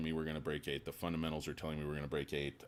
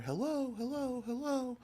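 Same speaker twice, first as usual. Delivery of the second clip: very high, sing-song hello, hello, hello; mock hello. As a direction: call hello as if knocking on an empty head. Knocking on analyst's head.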